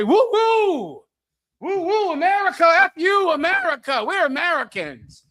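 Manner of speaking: high whooping hammed-up cheer; mock patriotic cheer. Mocking voters who cheer while the virus spreads. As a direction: whoop like a mindless flag-waver. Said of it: "They're gonna say".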